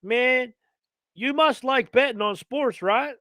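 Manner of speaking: put-on country accent. Carter quoted on phone.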